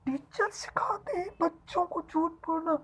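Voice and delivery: high, breathy mock-shocked whisper; scandalized disbelief. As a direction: repeat it as if appalled, in a high hushed voice. Mock shock at child's blaming teachers. (बनावटी हैरानी में ऊँची फुसफुसाहट)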